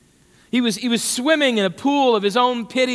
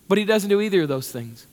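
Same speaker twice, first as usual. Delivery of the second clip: normal talking voice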